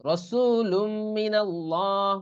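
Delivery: slow, drawn-out Quranic recitation; রসুলুম merged into মিনাল্লাহ with a held nasal m; idgham recitation. Shown as a demonstration. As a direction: recite রসুলুম মিনাল্লাহ slowly, long vowels sustained. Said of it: "এই জন্য এরকম পড়া হচ্ছে".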